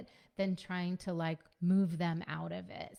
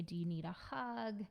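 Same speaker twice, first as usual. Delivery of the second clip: softer, tender question; gentle offer; 5 dB quieter than usual. Acted. Parent gently offering a hug to an upset child. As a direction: offer it softly and quietly.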